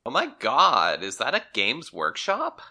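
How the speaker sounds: plain reading voice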